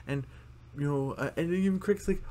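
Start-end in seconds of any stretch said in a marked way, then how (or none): none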